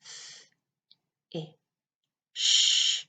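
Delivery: F, I, then a whispered sh; sounding out words. Shown as a demonstration. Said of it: "Let's sound it out".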